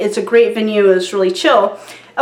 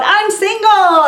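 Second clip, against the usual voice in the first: mock yell; 9 semitones higher, slower than usual. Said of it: "Evan yells out".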